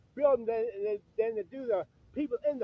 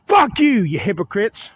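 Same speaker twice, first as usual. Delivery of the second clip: angry outburst; 4 semitones lower, 13 dB louder than usual. Real, not acted. Man rebuking churchgoers who laughed at his crying, angry outburst. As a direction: lash out loudly at them.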